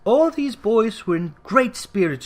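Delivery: plain reading voice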